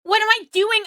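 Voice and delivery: ordinary talk, nothing marked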